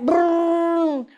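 high "brrrr" car imitation; playful engine rumble; engine noise. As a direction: mimic the little car speeding away.

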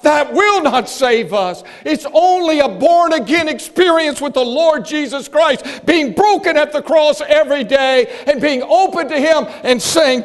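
loud, fervent pulpit preaching; preaching at full force. Acted. A preacher rousing his church, loud fervent preaching. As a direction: drive the sermon home to the congregation.

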